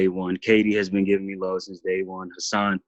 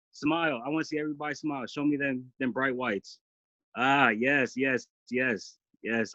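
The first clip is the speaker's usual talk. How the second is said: choked-up softness; 5 semitones higher, 7 dB quieter than usual. Real, not acted. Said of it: "This is emotional".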